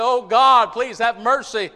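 loud, high acted plea; pleading cry for mercy. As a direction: cry out to God as a desperate sinner. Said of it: "begins to plead and to scream and to cry".

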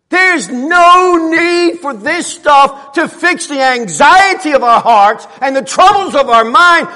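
loud, high-pitched impassioned sermon; preaching at full force. Real, not acted. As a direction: thunder it to the congregation, high and loud.

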